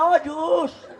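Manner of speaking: lightly mimicked boy's call; mock a kid calling the juice man; लड़के की पुकार की नकल. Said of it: "देखो स्टाइल कैसे बुला रहा". (लड़के की पुकार की हल्की नकल)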